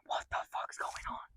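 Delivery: soft, breathless whisper of dread; whispered panic. Retold or imitated. Frightened narrator whispering inside the other realm. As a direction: hush it like you're scared to be heard.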